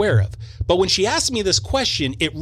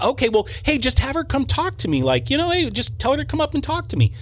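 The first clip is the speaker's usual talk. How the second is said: higher, eager, a bit nervous; 8 semitones higher than usual. Retold or imitated. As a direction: babble it out, eager to please, higher.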